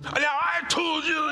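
high, loud mock angry snap; sudden hammed-up argument voice; angry outburst. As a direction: burst back into the fight. Resuming the heated argument.

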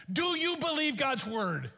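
usual speaking voice

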